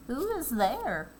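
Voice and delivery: a hint of a hoarse wolf; lightly voiced rough wolf. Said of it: "the hoarse voice of the wolf".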